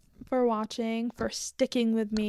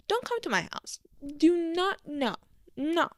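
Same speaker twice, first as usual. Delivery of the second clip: high insistent no; 6 semitones higher than usual. Real, not acted. Pleading with imagined intruder.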